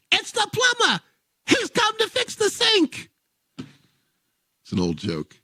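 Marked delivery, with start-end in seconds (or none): 0.1-3.1 very high parrot squawk; parrot punchline. Acted. Parrot answering the lady, very high squawk. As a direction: squawk it like the bird. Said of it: "The bird says".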